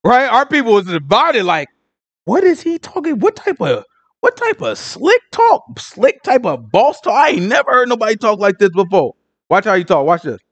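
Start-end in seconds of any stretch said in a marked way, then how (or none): 2.2-9.1 baffled disbelief. Acted. Teacher voicing the divided people hearing strange talk, baffled disbelief. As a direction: stretch the key words in squeaky disbelief.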